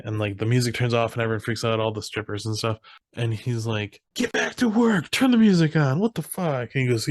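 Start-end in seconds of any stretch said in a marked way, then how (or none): 4.1-6.7 mock yell. Man yelling at the strippers.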